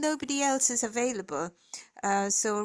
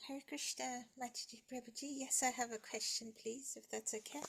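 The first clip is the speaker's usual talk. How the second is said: very faint and far from the mic; speaking much too softly to hear; barely audible; 15 dB quieter, faster than usual. Real, not acted. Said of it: "Your voice is very low".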